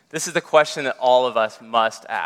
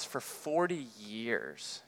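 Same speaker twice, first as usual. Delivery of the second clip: hushed slow repeat; 4 semitones lower, 17 dB quieter, much slower than usual. Acted. Repeated phrase, slowed and hushed.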